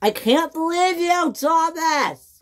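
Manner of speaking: very high, outraged scream. Acted. James screaming at Thomas over the crash. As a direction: shriek the blame at Thomas. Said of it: "screamed James".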